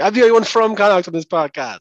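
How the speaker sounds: ordinary talk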